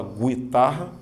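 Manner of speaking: guitarra with the u sounded, gwi-tarra; teacher showing the wrong guitarra. Shown as a demonstration. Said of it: "a letra U não é pronunciada".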